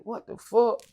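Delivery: high-pitched incredulous question; retold disbelief. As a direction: act out being floored by the news. Acted disbelief at the news.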